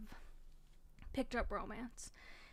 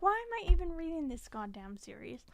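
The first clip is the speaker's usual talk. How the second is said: higher and louder, fed-up rhetorical question; exasperated self-quote; 6 semitones higher, 7 dB louder than usual. Retold or imitated. Asking herself why she keeps reading the series, exasperated and loud. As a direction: throw up your hands at the series. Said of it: "I'm like".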